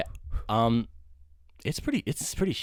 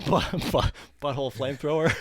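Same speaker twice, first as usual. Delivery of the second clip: ordinary delivery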